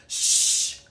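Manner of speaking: sharp loud shush; hushing. A narrator's reading. Goose hushing Duck over the egg, sharp shush. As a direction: hiss to hush Duck. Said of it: "Goose hushed".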